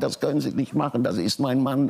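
high pleading cry, retold by the daughter; retold desperate scream. Mother begging the American officer for her husband, high desperate cry.

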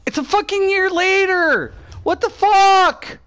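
high, real disbelief, slowing on what the fuck; exasperated disbelief. Real, not acted. Streamer to his useless team, high pitched disbelief. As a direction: voice jumps up in exasperated outrage. Teammates failing in match.

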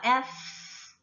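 F with a long hiss of leaking air; airy F sound. Shown as a demonstration. Teacher showing students the lip position, long breath on F. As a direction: lip lightly bitten, let air escape on F. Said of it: "아랫입술 살짝 깨물고 바람 새는 소리".

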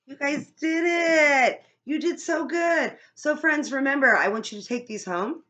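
high, loud and fast excited praise. Real, not acted. Praising children after song.

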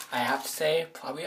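ordinary delivery

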